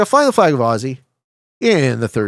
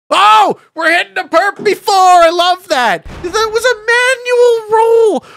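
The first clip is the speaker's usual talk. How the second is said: high, loud disbelieving yells; excited shock; 14 semitones higher, 5 dB louder than usual. Real, not acted. Streamer reacting to a player's quick quit, shrieking excitedly. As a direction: shriek with delighted outrage.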